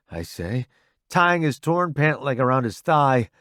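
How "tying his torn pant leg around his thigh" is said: ordinary delivery, nothing marked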